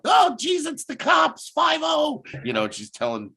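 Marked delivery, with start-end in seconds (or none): 0.1-2.2 very high-pitched panicked cry; hammed-up alarm, pitch shooting up; mock panic. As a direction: squeal it out like someone panicking at a raid. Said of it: "and she's panicking like".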